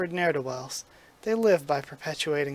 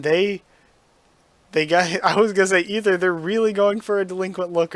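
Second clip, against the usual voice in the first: loud, laughing through the words; cracking up while reading the joke; real laughter breaking into the line; 3 semitones higher, 8 dB louder than usual. Real, not acted.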